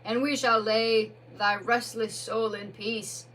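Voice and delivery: solemn Badger voice. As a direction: Badger lays a restless soul to rest, loudly. Badger banishes the ghost.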